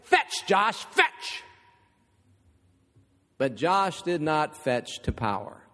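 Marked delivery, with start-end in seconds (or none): 0.0-1.4 gruff, forceful command to a dog; mock tough-guy gruff voice; gruff bodybuilder. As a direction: growl the order like a muscleman. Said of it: "in a real gruff voice".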